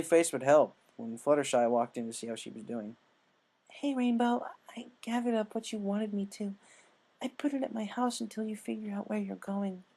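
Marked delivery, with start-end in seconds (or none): 3.7-9.8 gentle shy kindness; Fluttershy's voice. A narrator's reading.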